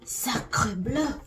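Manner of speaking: lightly put-on French accent, hammed-up; a hint of a silly French accent; bad French accent. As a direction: toss off a mock French exclamation. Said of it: "Horrible French accent".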